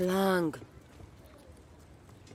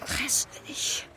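whisper; 11 semitones higher than usual. Acted. Whispered echo of grässlich.

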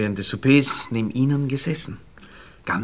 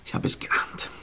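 tonlos, resigniert; flüstert kleinlaut; geflüstert; 7 dB quieter than usual. Acted. Verlegene Entschuldigung nach Versagen. (kleinlautes Flüstern)

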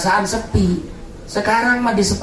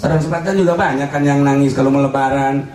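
normal talking voice

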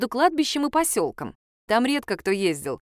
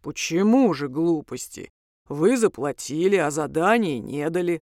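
low, deep creature voice; deep-voiced mild objection; demon voice; 4 semitones lower than usual. A narrator's reading. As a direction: reason politely in a demon's bass. Demon argues back in his bass.

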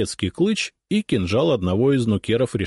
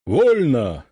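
sharp, loud parade order; shouted command; 5 semitones higher, 4 dB louder than usual. Acted. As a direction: bark it out as the commander. Ataman gives the order.

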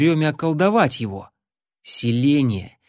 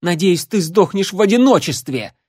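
angry shout; 7 semitones higher, 5 dB louder than usual. Acted. Nola yelling curse at Larry.

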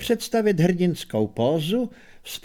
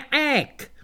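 ape shriek; 10 semitones higher than usual. Acted. Ape's shriek.

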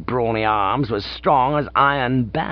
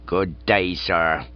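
curt, lower London policeman voice; 5 semitones lower, much slower than usual. Acted. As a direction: clip it short like a London bobby. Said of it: "said the constable shortly".

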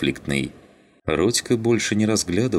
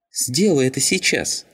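high-pitched, goading scoff; 9 semitones higher than usual. A narrator's reading. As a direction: dare him to go ahead, scornfully. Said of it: "хмыкнул Вафин". (с вызовом, хмыкая)

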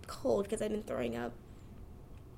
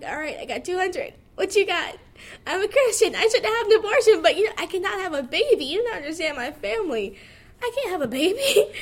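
higher and much louder frantic pleading; frantic panic; 8 semitones higher, 10 dB louder than usual. Retold or imitated. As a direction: rattle it off in a retold panic. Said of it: "and I'm like".

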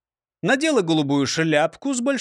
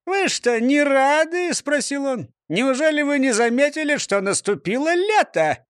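high jolly mayor voice; beaming, puzzled mayor; cheerful mayor; 8 semitones higher than usual. A narrator's reading. Mayor character voice.

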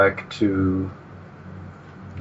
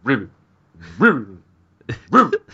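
frog voice; 4 dB louder than usual. Pretend. Co-host keeps imitating the pond frog, croaking.

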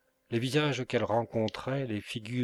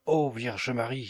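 slow, mildly alarmed exclamation; exclaiming; 2 semitones higher, 3 dB louder than usual. A narrator's reading. Maid invoking the Virgin in alarm, mild exclamation. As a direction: cry out a little, drawing it out. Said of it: "s'écria Agnette".

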